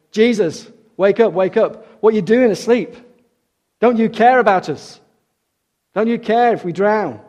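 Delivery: high, loud frantic pleading; panicked cry. Acted. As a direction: beg him to wake up, terrified. Terrified fishermen shake Jesus awake.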